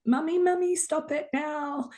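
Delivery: put-on high, whiny child's plea, slowing at the end; scared little child. Inner child pleading with mummy, in a high whiny voice. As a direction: whimper like a three-year-old begging mummy. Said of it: "I am like that three-year-old going".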